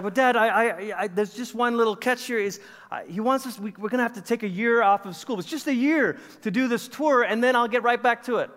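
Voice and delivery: high-pitched, halting plea to dad; retold son sheepishly selling the catch; nervous wheedling. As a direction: talk dad round, stumbling at first.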